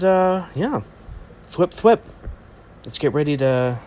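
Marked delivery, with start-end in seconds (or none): 1.5-2.0 web-shooter thwip. Retold or imitated. Web-shooter sound imitation.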